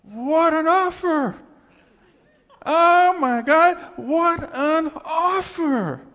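loud, high, slow sarcastic outcry; stunned shout at a stingy offer; incredulous exclamation. Real, not acted. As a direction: throw it out in mock disbelief. Mocking wife's offer.

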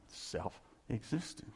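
very soft and slow; hushed. Acted. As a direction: breathe the word out slowly. Hushed awe.

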